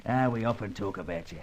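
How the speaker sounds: cheerful Cockney chat; London Cockney, chuckling fondly; Cockney accent. Acted. Old crook speaking of his wife, in Cockney.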